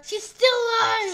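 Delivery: high, loud cry of disbelief; shocked exclamation. Real, not acted. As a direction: exclaim it loudly in amazement. Shocked that target survived.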